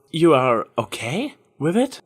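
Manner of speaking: slow, hesitant question; cautious. A narrator's reading. Trixie checking if Applejack approves, slow and cautious. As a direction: ask it warily, bracing for trouble. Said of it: "Trixie asked cautiously".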